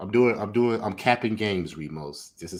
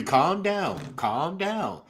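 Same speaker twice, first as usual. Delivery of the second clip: condescending player; 8 semitones higher, much slower than usual. Retold or imitated. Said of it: "He's telling the woman referee".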